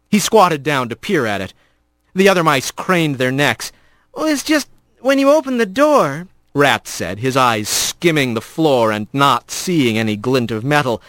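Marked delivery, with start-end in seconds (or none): 4.1-6.3 put-on higher, nervous young voice; a boy rat asking carefully. A narrator's reading. Young rat questioning the stern father, nervous and high.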